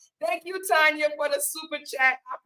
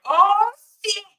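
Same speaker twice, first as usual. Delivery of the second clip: sudden high yelp of surprise; startled exclamation; 8 semitones higher, 8 dB louder than usual. Real, not acted. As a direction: caught off guard mid-swallow. Nearly choking on peanut.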